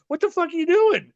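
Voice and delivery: high shocked shout; shocked yell. Retold or imitated. Retelling the wrestler's sneak attack, shocked high yell. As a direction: yelp it in disbelief.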